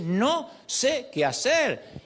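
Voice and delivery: very slow, high, drawn-out "No sé qué hacer"; very slow helpless prayer. Shown as a demonstration. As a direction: stretch out every word, at a loss. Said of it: "hay que decir". (muy lento y agudo, sin saber qué hacer)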